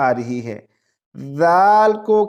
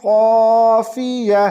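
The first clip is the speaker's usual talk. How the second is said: slow melodic chant, higher; Quran recitation; 6 semitones higher, much slower than usual. Shown as a demonstration. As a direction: recite the full line in tajweed. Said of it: "یہاں پر دیکھیں،".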